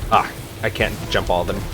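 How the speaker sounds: usual speaking voice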